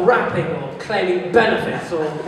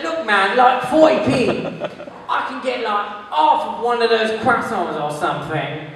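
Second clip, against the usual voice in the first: lightly voiced tramp, a touch higher; tramp haggling; 3 semitones higher than usual. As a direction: press the haggle as a hard-up tramp. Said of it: "He's like".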